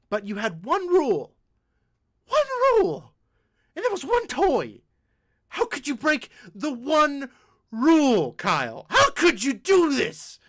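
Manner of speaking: high, rising exasperated scolding; mock scolding mom. Mom scolding Kyle over the toy rule, exasperated. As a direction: climb into an outraged scold. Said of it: "she says".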